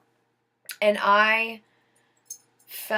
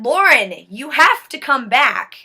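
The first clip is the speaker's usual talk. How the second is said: louder, a mild mock yell of her name; mom yelling; 2 semitones higher, 8 dB louder than usual. Mother calling her dying daughter, mild mock yell. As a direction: call her back urgently, not full scream. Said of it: "she's screaming at me".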